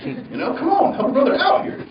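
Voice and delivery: high, strained "come on, man" begging for air; exasperated with the guy on the mask, pleading up high; frustrated plea. Retold or imitated. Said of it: "I remember getting so mad at that guy".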